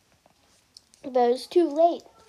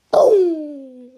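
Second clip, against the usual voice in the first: loud, sudden boom much louder; explosion boom; 3 semitones higher, 12 dB louder than usual. A narrator's reading. Narrator voices the castle blowing apart with a loud boom. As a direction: blast it out like an explosion.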